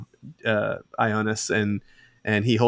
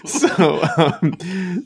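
talking through giggles, pitched up; real laughter; 9 semitones higher, 3 dB louder, much slower than usual. Real, not acted. Game master tries to get back to the story, real laughing. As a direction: try to resume while still laughing.

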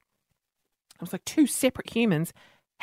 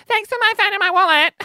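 bright thanks; 12 semitones higher, 4 dB louder than usual. Retold or imitated.

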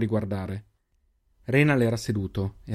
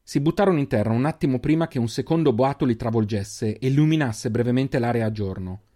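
ordinary delivery

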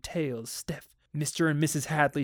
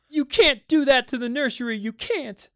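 high, loud protest, easing on the last words; hysterical child; 10 semitones higher, 6 dB louder than usual. A narrator's reading. Children protesting the nursery being switched off, hysterical. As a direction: cry out in high-pitched, hysterical objection. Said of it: "The two children were in hysterics".

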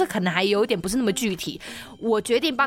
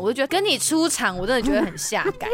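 ordinary talk

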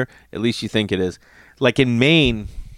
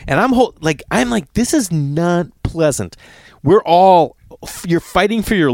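panicked; 6 semitones higher than usual. Retold or imitated. Clinging to ripping raft.

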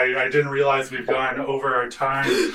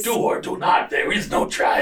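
Yoda; 3 semitones higher than usual. Pretend. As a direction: croak it like a tiny old sage. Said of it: "I did a Yoda voice".

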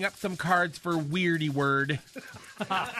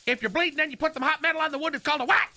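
high, fast, eager questions rising on what; imitating a quiz voice; 9 semitones higher, much faster than usual. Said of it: "I can't do the voice".

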